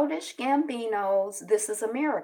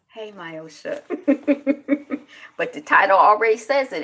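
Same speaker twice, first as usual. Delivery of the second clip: ordinary talk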